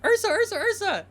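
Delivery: loud, very high excited calling; high-pitched, eager shout; calling out a name. Acted. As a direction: call your friend's name over and over, loud and high. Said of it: "We hear".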